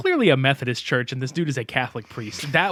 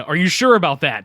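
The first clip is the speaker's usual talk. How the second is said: a hint of the John Cena meme, a bit louder; John Cena impression; 3 semitones higher, 7 dB louder than usual. Teasing his co-host with a lightly voiced meme line. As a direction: lightly mimic the meme catchphrase. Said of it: "John Cena".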